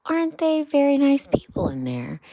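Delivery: a timid little Mole, mildly anxious; nervous Mole. Acted. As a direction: Mole asking with a touch of worry. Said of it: "with a trifle nervously".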